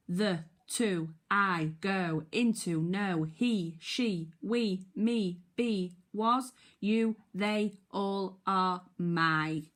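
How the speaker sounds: loud, crisp word call-outs; tricky word drill. Shown as a demonstration. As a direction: read each word out for the class.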